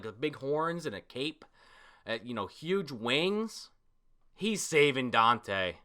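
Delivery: usual speaking voice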